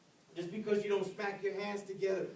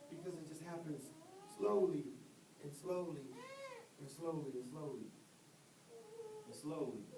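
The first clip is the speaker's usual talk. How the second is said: softer and softer, letting it sink in; 6 semitones lower, 11 dB quieter than usual. Acted. Slow creeping loss of control.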